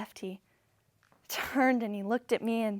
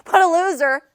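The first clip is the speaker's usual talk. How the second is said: higher, loud contemptuous jab; angry scorn; 5 semitones higher, 9 dB louder than usual. Acted. Daughter fuming at the man behind counter, loudly scornful. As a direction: throw it out in disgust. Repeats angry reaction to insult.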